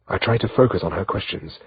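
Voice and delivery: plain reading voice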